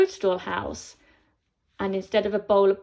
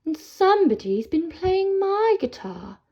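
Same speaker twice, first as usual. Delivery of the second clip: higher, a lightly voiced mother troll; Mummy Troll; 8 semitones higher, 3 dB louder than usual. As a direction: mum troll, surprised and put out. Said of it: "Mummy Troll says".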